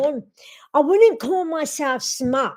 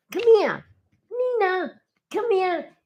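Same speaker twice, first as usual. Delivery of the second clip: higher, sing-song calling of the dog; pet talk; 5 semitones higher than usual. Real, not acted. Owner coaxing her dog Nina to come. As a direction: coax the dog over sweetly.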